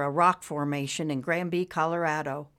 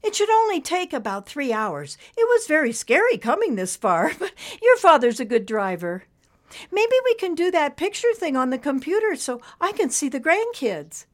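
much higher, bright put-on cheer with a laugh; upbeat grandmother; 11 semitones higher, 6 dB louder than usual. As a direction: sound cheerful to hide worry. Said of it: "how upbeat she was sounding".

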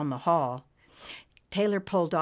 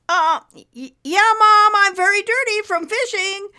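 nervous stammer; 11 dB louder than usual. Acted. Caught, stammering to mom.

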